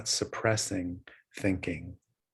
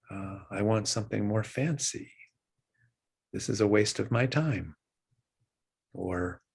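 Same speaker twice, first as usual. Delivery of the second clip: guided meditation. Acted. Teacher guiding students' breathing, calm meditation voice. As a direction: ease listeners back to the breath.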